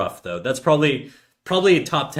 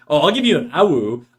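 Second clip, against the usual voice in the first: teasing voice; 3 semitones higher, 5 dB louder than usual. Pretend. Teasing before howl.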